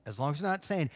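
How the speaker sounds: usual speaking voice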